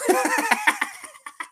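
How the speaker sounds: high burst of laughter. Real, not acted. Host cracking up at a viewer's comment, high laugh. Reading a viewer's joke aloud.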